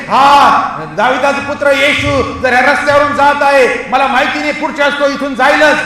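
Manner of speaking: full-force sermon cry; urgent preaching. Acted. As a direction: plead as if it is the last chance. Preaching as the blind man, urgent.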